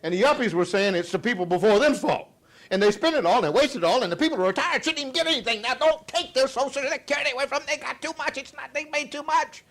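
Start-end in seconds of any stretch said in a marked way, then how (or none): 2.7-9.6 high-pitched, rushing complaint; mock indignant rant. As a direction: pile up the blame in a high voice. Mock generational blame rant, voicing complainers.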